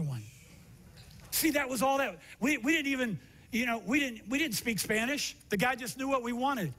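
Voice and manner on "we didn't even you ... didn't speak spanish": usual speaking voice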